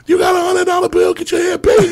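high-pitched imitated club hollering; background club shouting. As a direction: shout like someone across a noisy club. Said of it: "you can hear in the back".

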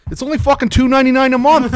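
loud Boston shout. Pretend. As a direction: belt out the price like a rowdy Boston guy. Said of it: "read it in a Boston accent".

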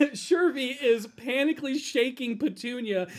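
ordinary talk

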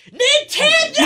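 very high, loud screamed imitation of an excited kid; possessed-child scream. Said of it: "the pitch of her voice ... Like a child possessed".

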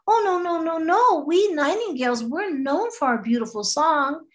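a proud little bird defending her song; hurt nightingale. Acted. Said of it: "And the nightingale said".

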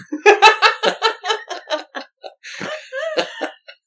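a loud, high, open belly laugh; big burst of laughter. Real, not acted. Laughing at the old man's joke.

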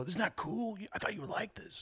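small, soft, high imitation of a fan; hushed mock-kid voice; eager kid. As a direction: show it off shyly, hoping for approval. Imitating the kid showing his photo.

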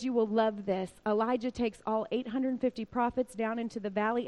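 usual speaking voice

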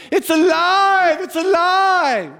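high, loud, slow triumphant cry; drawn-out ecstatic Frankenstein scientist shout; mad scientist cry. Retold or imitated. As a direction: shout it like a mad scientist whose creation wakes. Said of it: "he goes".

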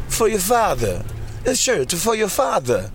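high, mock-Italian Godfather quote; Jared Leto accent. Host retelling his mobster quote to a fan. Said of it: "And I was like".

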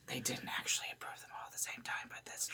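fast unvoiced whisper aside. Real, not acted. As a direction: drop to a quick whisper. Aside during the town crier reading.

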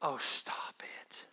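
slow whispered brush-off, falling at the end; whispered scorn, dying away; exasperated whisper. Retold or imitated. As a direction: breathe it out in weary disgust. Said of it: "That's what it means".

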